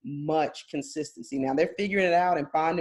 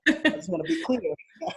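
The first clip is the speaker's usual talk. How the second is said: higher-pitched words breaking into laughter; laughing; 6 semitones higher than usual. Real, not acted. Host laughingly covering themself on a prediction, laughing. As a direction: talk through a laugh, pitched up.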